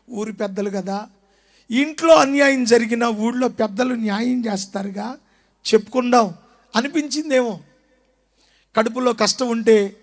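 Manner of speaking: ordinary talk, nothing marked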